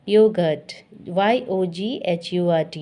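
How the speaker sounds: ordinary talk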